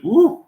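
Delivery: high-pitched "woo" at the heat; real whoop of heat relief; exclaimed woo. Real, not acted. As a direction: let out a quick high whoop.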